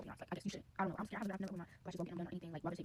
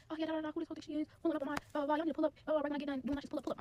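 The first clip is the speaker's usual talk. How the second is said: higher-pitched imitation of a worked-up caller; agitated phone rant; 7 semitones higher, 4 dB louder than usual. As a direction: act out rallying friends by phone, pitched up and urgent. Lash tech phoning for backup.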